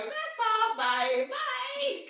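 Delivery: high-pitched brush-off; squeaky, dismissive send-off; acted hasty farewell. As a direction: sing out a mock goodbye to escape. Acts out her quick exit.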